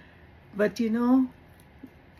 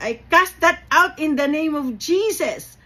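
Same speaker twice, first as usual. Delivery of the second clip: much higher and louder proclamation; bold rebuke; 7 semitones higher, 6 dB louder than usual. Retold or imitated. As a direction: cast it out with full faith. Rebuking the angry client.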